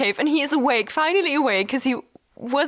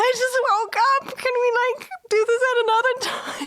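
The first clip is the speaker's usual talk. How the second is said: whiny; 11 semitones higher than usual. Retold or imitated.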